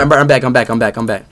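usual speaking voice

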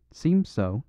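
ordinary delivery

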